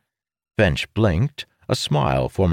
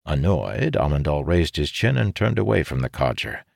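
usual speaking voice

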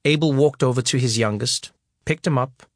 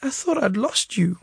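higher, choked with tears; tearful; 9 semitones higher than usual. A narrator's reading. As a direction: plead through crying. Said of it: "I kept bawling and bawling".

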